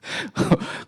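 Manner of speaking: high, breathless real laugh; helpless quiet laughter at yourself. Real, not acted. Preacher amused by his own story about dinner, laughing.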